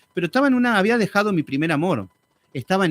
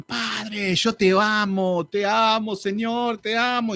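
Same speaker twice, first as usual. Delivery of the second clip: gushing prayer; 3 semitones higher, much slower than usual. Retold or imitated. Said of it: "gente que oraban así".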